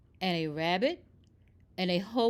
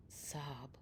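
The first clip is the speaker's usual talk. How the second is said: whispered sob; 7 semitones lower, 17 dB quieter than usual. A narrator's reading. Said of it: "he heard a, a sound".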